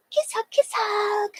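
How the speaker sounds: high, slow little squeak; cutesy kiss hug. Acted. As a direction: stretch it out in a tiny high voice. Repeats the kisses to chat.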